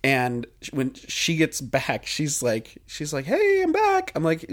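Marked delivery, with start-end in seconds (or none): 3.2-4.1 high cheery hey, imitating friend; bubbly greeting from the friend. Friend quoted returning.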